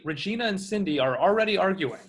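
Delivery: ordinary talk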